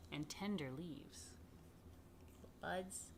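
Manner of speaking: ordinary delivery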